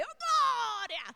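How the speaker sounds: high, slow, drawn-out joyful cry. Retold or imitated. (grito de alegria alto e arrastado)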